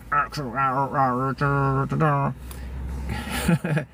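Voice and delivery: imitated eighties techno robot babble; robotic techno voice noise. Recalling eighties techno videos for viewers, mock robotic vocal noises. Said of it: "watch those old videos, like those techno".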